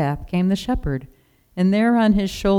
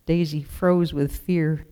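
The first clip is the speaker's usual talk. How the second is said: ordinary delivery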